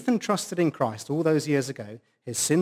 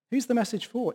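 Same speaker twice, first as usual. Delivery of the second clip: ordinary talk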